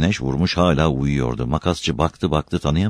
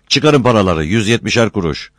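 raised, louder demand for money; calling out orders; 5 semitones higher, 4 dB louder than usual. Acted. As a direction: call it out to the whole group. Boss collecting fares from crew.

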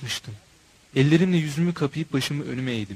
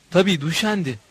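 plain reading voice

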